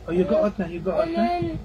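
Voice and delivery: high sing-song shooing. Real, not acted. Mother gently sending her toddler out, high coax. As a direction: coax the little one out, pitch way up.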